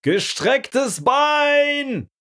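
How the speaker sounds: very high, drawn-out yell of protest; outraged shout. Retold or imitated. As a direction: scream at the ref, stretching it out. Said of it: "schrie Christine".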